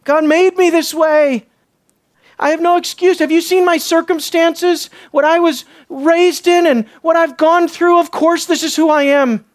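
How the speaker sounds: high, protesting self-justification; mock-defensive appeal; passing the blame. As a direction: make excuses, pleading your circumstances. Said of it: "Passing the blame".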